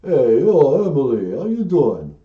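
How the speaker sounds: loud tough-guy caller impression; Sly Stallone. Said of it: "Oh, it's Sly Stallone".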